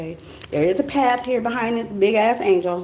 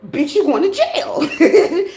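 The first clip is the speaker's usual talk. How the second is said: sassy punchline; 6 semitones higher, 3 dB louder, much slower than usual. Acted. Punchline about breaking laws.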